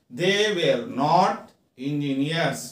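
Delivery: very slow, each word apart; slow teaching read. Shown as a demonstration. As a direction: dictate it slowly to students. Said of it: "teacher reads model sentence".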